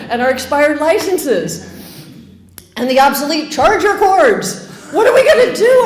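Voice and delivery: high, fast hammed-up despair with laughter; mock panic. Couple lamenting to the pub about losing the tins, hammed-up panic. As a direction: wail about the tins in comic alarm.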